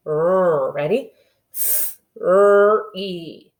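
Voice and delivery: "R" and "E" split into separate sounds; sounding out. Shown as a demonstration. Teacher segmenting the word three for kindergartners. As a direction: say each sound apart. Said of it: "we know what R says".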